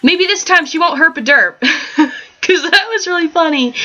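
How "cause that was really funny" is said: ordinary talk, nothing marked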